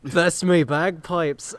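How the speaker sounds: a light Scottish lilt; Scottish accent. Acted. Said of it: "Did he just become Scottish?".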